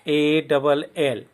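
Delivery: slow letter-by-letter spelling; teacher spelling fall; spelling aloud. Shown as a demonstration. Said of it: "अ, F".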